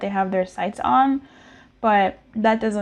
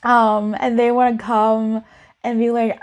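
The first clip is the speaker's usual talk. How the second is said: usual speaking voice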